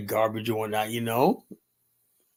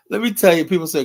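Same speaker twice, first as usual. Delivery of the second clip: excited; 5 semitones higher, 5 dB louder than usual. Real, not acted. Excited lead-in to Liberia story.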